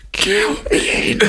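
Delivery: high, whispered whimpering plea; whining voice. Retold or imitated. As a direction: whine it out slowly and weakly. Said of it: "this little whining voice saying".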